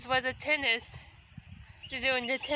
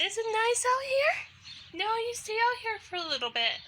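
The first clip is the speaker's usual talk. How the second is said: talking to a pet; 8 semitones higher than usual. Real, not acted. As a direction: coo up high to the cat. Talking to her cat.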